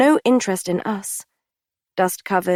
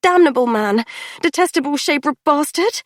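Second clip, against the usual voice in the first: swore; 7 semitones higher, 3 dB louder than usual. A narrator's reading. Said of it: "I swore".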